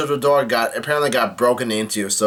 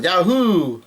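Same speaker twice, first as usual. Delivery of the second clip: higher, drawn-out retold whoop; Luffy's mock yell; 5 semitones higher than usual. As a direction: whoop lightly, not at full volume. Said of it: "Luffy yells out".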